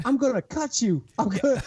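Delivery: high-pitched imitation of yelling; mock yell. Imitating Chip threatening his friend, high mock yell. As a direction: squeal a playful threat. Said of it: "Chip's yelling".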